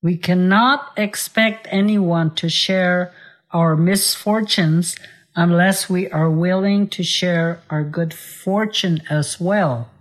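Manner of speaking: ordinary talk, nothing marked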